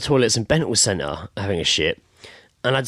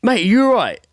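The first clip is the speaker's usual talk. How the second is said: loud, high call through the cubicles; imitated worried neighbour; 14 semitones higher, 6 dB louder than usual. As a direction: act out shouting over to check on him. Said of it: "I had the other guy".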